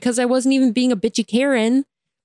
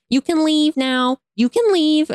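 mock rude send-off, pitched up; rude dismissal; 7 semitones higher, slower than usual. Worker telling a customer to go, mock rude. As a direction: imitate a pushy worker repeating himself, higher voice. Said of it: "just being, like, rude".

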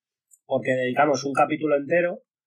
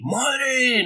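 sudden high amazed "Madre"; amazed gasp; 11 semitones higher than usual. Retold or imitated. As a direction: blurt it out in awe. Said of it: "no vas a decir".

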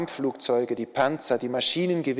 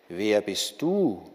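lower, slow echo of the question; echoing call; 4 semitones lower, slower than usual. A narrator's reading. The stranger calls the question back, a low slow echo. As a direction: answer like a hollow echo, lower and slower. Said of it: "rief der Fremde zurück".

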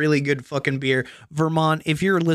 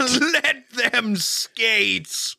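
booming biblical narration; 7 semitones higher, much slower than usual. Acted. Echoing the God line.

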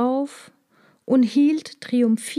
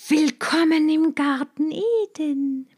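cold hissing lizard voice; 7 semitones higher than usual. Acted. Snake lures the woman from sign.

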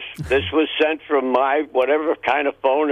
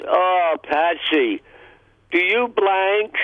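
put-on leering drunk come-on; 5 semitones higher, much slower than usual. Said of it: "And he says".

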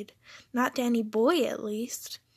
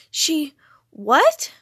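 loud, high disbelieving outburst; stunned exclamation leaping up; shocked; 4 semitones higher, 10 dB louder than usual. A narrator's reading. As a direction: blurt it out in shock. M shocked by the announcement.

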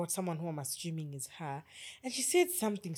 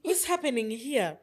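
retold disbelief; 6 semitones higher, 8 dB louder, slower than usual. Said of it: "I was like".

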